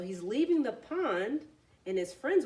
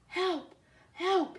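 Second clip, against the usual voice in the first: feeble groan; 9 semitones higher than usual. A narrator's reading. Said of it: "he groaned feebly".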